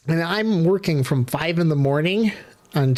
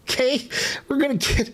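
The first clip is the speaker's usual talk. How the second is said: high, pinched mock voice; higher mocking voice; 10 semitones higher than usual. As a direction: squeak it out in a higher voice. Caller gets mad.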